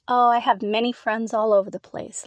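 ordinary delivery, nothing marked

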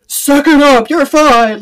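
playful stern self-talk; mock tough talk. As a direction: snap it at yourself like a drill sergeant, half joking. Mock pep talk to stop tears.

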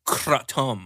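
Kratom as "kra-TOM", drawn out; stretched "kra-TOM" for Kratom; Hamilton Morris pronunciation. Retold or imitated. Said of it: "As Hamilton Morris would say".

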